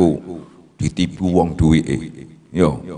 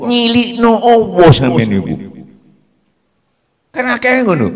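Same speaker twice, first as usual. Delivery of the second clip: much higher and louder scolding rebuke; 6 dB louder than usual. Acted. Preacher sharply rebuking listeners who belittle Allah.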